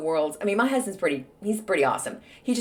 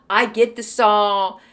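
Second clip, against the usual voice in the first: usual speaking voice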